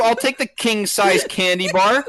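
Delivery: put-on loud, high, ranting at a rude kid; mock furious rant. Retelling the rude kid at his door, loud and ranting. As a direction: blow up like an offended neighbor. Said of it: "you were so loud".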